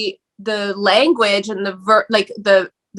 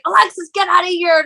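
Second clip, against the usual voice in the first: high, agitated retold yell; 8 semitones higher than usual. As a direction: imitate someone ordering a drunk friend out. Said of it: "like, yelling".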